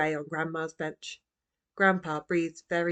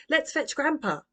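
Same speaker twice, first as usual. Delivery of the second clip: put-on much higher, urgent girl; urgent child; 9 semitones higher than usual. A narrator's reading. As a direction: hurry everyone along. Roo rushes.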